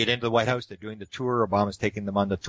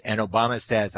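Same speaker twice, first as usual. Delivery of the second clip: ordinary delivery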